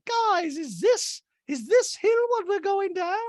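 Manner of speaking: high worried lady voice; Dorit impression. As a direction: ask it anxiously like Dorit. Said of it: "Dorit's like".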